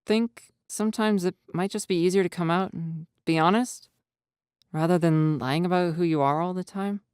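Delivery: put-on shy boy voice, tentative; tentative Jack. A narrator's reading. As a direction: gently suggest honesty. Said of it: "Jack asks tentatively".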